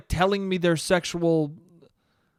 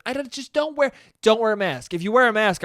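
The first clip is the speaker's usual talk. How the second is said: higher and louder, pushy; mock insistence; 5 semitones higher, 5 dB louder than usual. Imitating a friend bullying others out of masks. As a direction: push him hard not to wear one.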